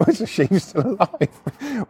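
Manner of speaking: retelling a mate's giggly questions; imitated giggling. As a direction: let laughter break into the question. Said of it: "Tyrone's still laughing now".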